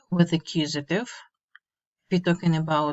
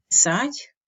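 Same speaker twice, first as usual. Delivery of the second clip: usual speaking voice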